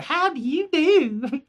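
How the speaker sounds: put-on high, slow sweet old lady; hammed-up old woman. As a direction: coo it out like a prim old dear. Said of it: "here comes the old lady".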